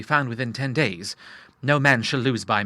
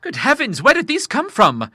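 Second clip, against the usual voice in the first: very high-pitched bewilderment; astonished cry; 13 semitones higher, 6 dB louder than usual. A narrator's reading. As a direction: exclaim in utter amazement. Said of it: "he cried".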